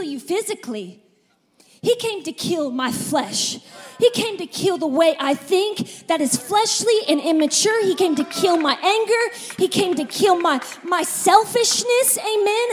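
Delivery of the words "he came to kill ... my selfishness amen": loud, driving preacher's build; loud pulpit preaching; preaching at full force. Acted. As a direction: hammer each line home to the congregation.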